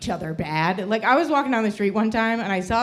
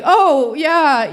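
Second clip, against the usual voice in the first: higher, loud overeager agreement; mock breezy acceptance; 5 semitones higher, 7 dB louder than usual. Teen self answers her crush's rejection, overeager agreement. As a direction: cover the rejection with eager agreement. Acted seventeen-year-old's reply to rejection.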